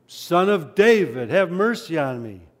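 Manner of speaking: loud mock yell for mercy. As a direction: call out over the crowd. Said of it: "There's a guy yelling out".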